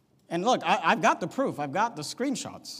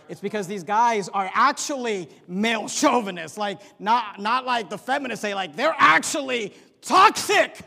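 much higher, louder, climbing to a shout; preaching shout; 4 semitones higher, 8 dB louder than usual. Real, not acted. Preacher shouting at the chauvinist preachers he denounces. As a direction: rail against them at full force.

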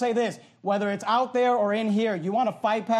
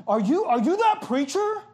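higher, louder voice for the stranger; a lightly voiced loud stranger, raised and pressing; 5 semitones higher than usual. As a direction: imitate a pushy man calling out. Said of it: "he starts talking really loud to me".

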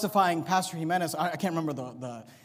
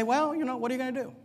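mock shrug; 4 semitones higher, 6 dB quieter than usual.

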